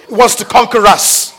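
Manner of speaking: loud, rousing sermon-style pep talk; dad preaching full force to his daughter on the trail; preacher mode. Retold or imitated. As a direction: preach at a seven-year-old about a hill. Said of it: "what it's like climbing with a preacher".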